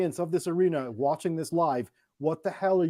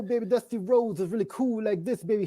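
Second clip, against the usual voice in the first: Dusty Rhodes impression; 4 semitones higher than usual. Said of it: "funny accent and a lisp".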